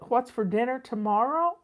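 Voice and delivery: ordinary delivery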